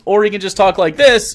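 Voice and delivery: another character voice. Shown as a demonstration.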